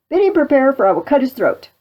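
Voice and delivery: loud threat, starting high; acted threat. Pistol threatens the French prisoner, loud and menacing. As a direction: bluster like a would-be executioner.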